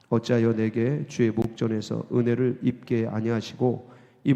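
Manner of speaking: low, steady Bible reading; scripture reading. Acted. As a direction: read the lament solemnly and evenly.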